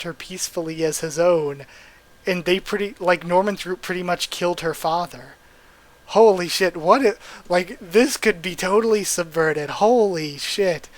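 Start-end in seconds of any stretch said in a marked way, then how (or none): 6.1-10.9 loud stunned excitement; freaking out. Real, not acted. Reader stunned by a plot twist, loud excitement. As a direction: blurt it out, blown away. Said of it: "I'm freaking out at just the thought of it".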